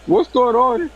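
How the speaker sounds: high-pitched mock bewilderment; confused. Confused prank victim asks what's happening, high mimicked bewilderment. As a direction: imitate a thoroughly confused man. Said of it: "He was so confused".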